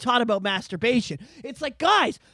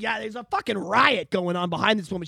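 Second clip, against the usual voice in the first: usual speaking voice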